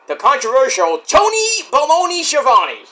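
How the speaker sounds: high, hammed-up name call; playful ring-announcer flourish; mock announcer introduction.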